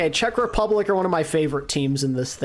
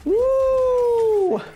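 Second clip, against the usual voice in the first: very high, sustained woo; excited whoop; 4 dB louder than usual. Real, not acted. Streamer cheering the four kills, high excited whoop. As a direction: let out a high celebratory whoop.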